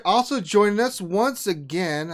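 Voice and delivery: ordinary talk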